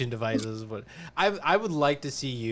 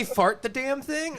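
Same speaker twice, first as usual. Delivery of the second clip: high mock squeal; 13 semitones higher, slower than usual. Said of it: "Did she say".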